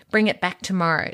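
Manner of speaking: ordinary delivery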